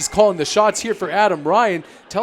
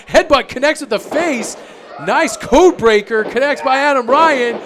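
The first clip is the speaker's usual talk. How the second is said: higher, louder building call; excited call; 7 semitones higher, 5 dB louder than usual. Real, not acted. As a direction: build the hype toward the pin. Calling a near finish.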